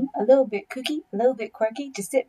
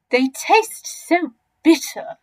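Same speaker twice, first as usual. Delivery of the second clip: slow, louder, higher complaint; not so big crocodile, disgusted; 6 semitones higher, 7 dB louder, slower than usual. Acted. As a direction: drag it out with distaste.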